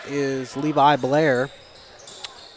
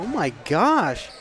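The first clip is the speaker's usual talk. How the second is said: drawn-out, higher-pitched disbelief; exasperated disbelief; 4 semitones higher, slower than usual. Real, not acted. Commentator reacts to a third foul call, in slow disbelief. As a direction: stretch it out, stunned by the call.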